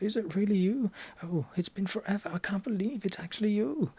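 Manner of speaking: overjoyed to see family again; joyful reunion. Acted. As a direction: gush at a long-lost relative. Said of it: "You could say something, uh, like".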